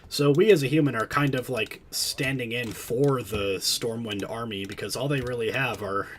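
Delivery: ordinary delivery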